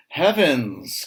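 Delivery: high, loud shocked exclamation; shocked cry. Acted. Farmer's wife shocked at the filthy pig pen, crying out. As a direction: gasp it out as a startled housewife. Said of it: "cries the farmer's wife".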